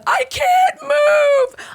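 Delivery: very high, drawn-out wail; slow, high-pitched dramatic scream; retold desperate shout. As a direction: cry out helplessly, stretching each word. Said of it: "once I said".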